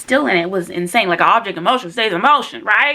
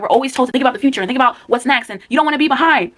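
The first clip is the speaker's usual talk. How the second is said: ordinary delivery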